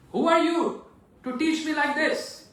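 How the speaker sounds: put-on enraged Parshuram shouting at young Lakshman; furious sage. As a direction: shout down the cheeky boy.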